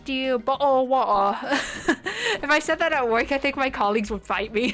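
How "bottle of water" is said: louder, hammed-up British accent; mock British accent. Streamer teasing colleagues' way of talking, mock British accent. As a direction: put on a jokey British voice. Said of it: "in a very British voice".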